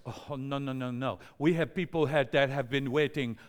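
badly imitated French airline clerk; hammy mock-French refusal at the counter; horrible French accent. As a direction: put on a clumsy French accent. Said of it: "That's a horrible French accent".

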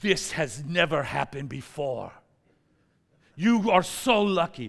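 slow, put-on French accent; hammy French-accented astonishment; French clerk amazed. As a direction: stress each word in mock French. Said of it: "she goes".

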